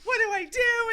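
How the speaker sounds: high, loud and laughing in mock panic; panicked woman stuck in a restroom, squealing loudly. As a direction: squeal it like you're trapped in the restroom. Panicked retold thought.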